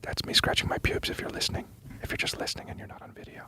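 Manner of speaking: hushed mock ASMR; ASMR whisper. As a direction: close whispered question into the mic. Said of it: "Can you do some A S M R".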